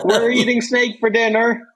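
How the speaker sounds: put-on high playful kid voice; mock kid. Joking what the cousin kids would say, high and gleeful. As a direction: pitch it up like a gleeful child.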